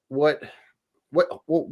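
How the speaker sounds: ordinary delivery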